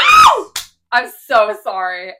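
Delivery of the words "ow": sharp, high, loud cry of pain; pained yelp. Real, not acted. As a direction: yelp as if something hit you.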